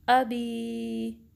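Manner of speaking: अबी with a crisp hamza on अ, said very slowly; sharp hamza, drawn out. Shown as a demonstration. Teacher recites अबी from Surah Masad for students. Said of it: "इस तरीके से आप भी पढ़ें मेरे साथ".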